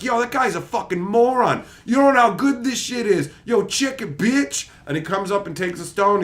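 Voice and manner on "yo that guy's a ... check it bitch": high, loud cocky brag; hammed-up trash talk; mock hype swagger. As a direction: show off with swagger. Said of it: "Doctor Strange is like".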